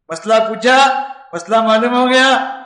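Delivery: usual speaking voice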